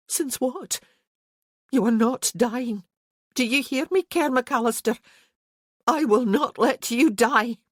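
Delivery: a frightened wife's fierce plea; Isabel, desperate. A narrator's reading. Narrator voices Isabel, pleading.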